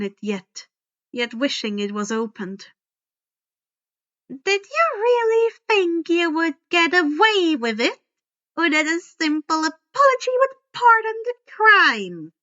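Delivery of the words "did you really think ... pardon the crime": higher, loud, cold taunt; fury demanding a confession; menacing accusation. A narrator's reading. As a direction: corner the boy with scorn. Monster teacher accusing.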